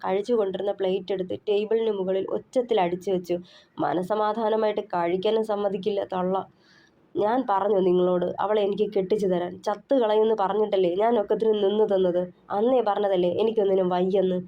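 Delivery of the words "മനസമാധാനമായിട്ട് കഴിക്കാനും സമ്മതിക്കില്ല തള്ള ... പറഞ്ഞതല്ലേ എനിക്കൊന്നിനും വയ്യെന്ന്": usual speaking voice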